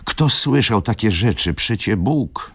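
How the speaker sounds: a lightly voiced worried railwayman; railwayman pleading. A narrator's reading. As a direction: raise the pitch, fretting over the gentleman. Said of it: "mówił człowiek".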